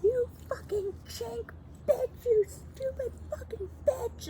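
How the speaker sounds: high crying shriek, slowed and stretched. Retold or imitated. Imitating the yelling driver in a high shriek. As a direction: screech it like the woman in the truck. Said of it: "She was like".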